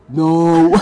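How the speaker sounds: loud, high group shout of no; mock crowd yelling no. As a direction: bellow it like a hostile crowd. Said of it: "And they all went".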